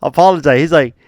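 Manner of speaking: normal talking voice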